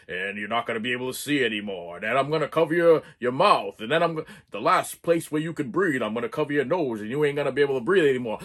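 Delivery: lightly voiced killer explaining each step; masked killer voice. Masked killer taunts his tied-up victim, low and menacing. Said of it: "He's like".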